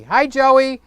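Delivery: high, loud call-out to Joey; excited shout-out; loud hello. Real, not acted. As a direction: greet him with a big shout-out. Shout out to viewer.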